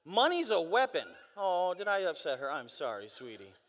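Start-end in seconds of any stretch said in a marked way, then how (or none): 1.4-3.5 low, soft, tender aside; soft apology. Real, not acted. Preacher apologizing to someone he may have upset, soft and tender. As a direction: drop down low and soothe her.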